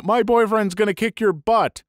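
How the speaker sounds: narrator's restrained version of a yelled threat; mild yell. Retold or imitated. Girl threatening the man walking off, mild yell. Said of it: "she's yelling".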